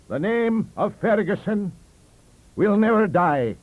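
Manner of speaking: high, loud, slow pronouncement; grand declaration. A narrator's reading. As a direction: declare it proudly to the table. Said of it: "he declared".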